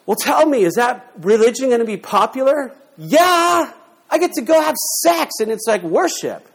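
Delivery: high, loud, gleeful mock enthusiasm; mock eager follower. Preacher mocking the temple worshipper, high and gleeful. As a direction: play the thrilled pagan who gets to sin.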